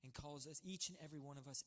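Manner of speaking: ordinary delivery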